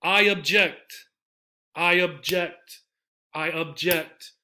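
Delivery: slow "I ob-JECT", reduced "ob"; second-syllable stress. Shown as a demonstration. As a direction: land on JECT in object. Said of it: "The verb".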